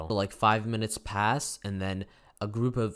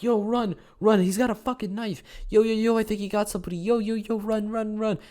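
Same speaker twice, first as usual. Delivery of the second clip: urgent, high, loud prank warning; feigned panic; 14 semitones higher, 7 dB louder than usual. Retold or imitated. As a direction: yell high and frantic, faking a chase. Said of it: "pretending like we're getting chased".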